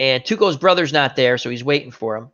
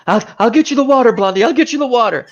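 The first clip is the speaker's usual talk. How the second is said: fast, high, pleading promise; imitating panicky Tuco; 8 semitones higher, 4 dB louder, faster than usual. Tuco begs dying Blondie to hold on, high and frantic. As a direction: gabble it high and quick, desperate to keep him alive.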